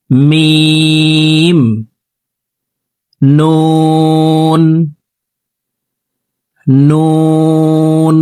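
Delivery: letters held slow and sung; recite along. Shown as a demonstration. Teacher leads viewers reciting letters, slow chant. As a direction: chant each letter long for students to repeat. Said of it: "আমার সঙ্গে বলুন".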